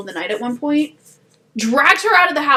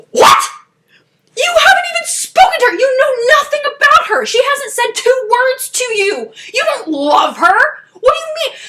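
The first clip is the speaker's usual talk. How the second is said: high, loud, cracking outrage; outraged rant; 13 semitones higher, 5 dB louder than usual. Real, not acted. Scolding the book hero, a high loud outraged rant. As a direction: yell at him in disbelief.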